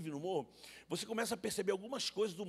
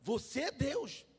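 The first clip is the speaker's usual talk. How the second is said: ironic accusation; 6 semitones higher, 4 dB louder than usual. Retold or imitated. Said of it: "Eu fui irônico com ela".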